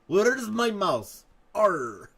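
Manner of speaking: slow, loud Russian pirate demand. Acted. Russian pirate hunting for his mouse, loud hammed-up accent. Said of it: "How would a Russian pirate sound?".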